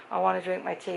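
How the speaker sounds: normal talking voice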